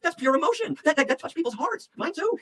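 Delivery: very high, emotional conviction; real impassioned insistence. Real, not acted. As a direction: argue passionately that feeling moves people. Defending emotion as world-changing.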